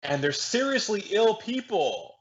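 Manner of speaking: slow, high-pitched mocking quote; mocking echo. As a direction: parrot it back high and drawn out. Mockingly echoes the clip just played.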